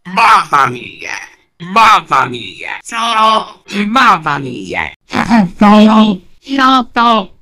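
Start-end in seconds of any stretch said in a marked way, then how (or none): 0.0-4.9 lower, hammed-up Italian exclamation; low, theatrical mamma mia in an Italian cartoon voice; cartoon Italian plumber. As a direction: groan it like a cartoon Italian hero.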